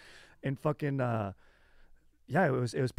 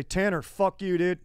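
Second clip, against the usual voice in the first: loud, high mock Midwest accent; 7 semitones higher, 8 dB louder, slower than usual. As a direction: yell it as a caricature Midwesterner. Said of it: "that's how you test a Midwest accent".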